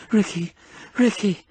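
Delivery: ordinary delivery, nothing marked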